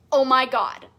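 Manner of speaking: excited exclaim. Acted. Shows excited reaction online.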